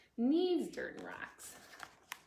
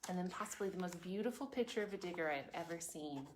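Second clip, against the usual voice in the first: normal talking voice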